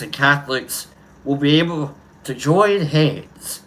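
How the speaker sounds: rising, ringing preacher-style delivery with long pauses; oratory speech reading. Acted. Streamer reading the speech to his chat, orating. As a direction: build each "let freedom ring" like a civil rights orator. Recites the famous freedom speech.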